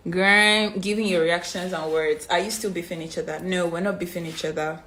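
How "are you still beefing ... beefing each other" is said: ordinary delivery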